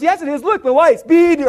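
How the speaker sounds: put-on high, loud child voice; excited little son. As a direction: squeal it like a thrilled kid. Acts excited young son.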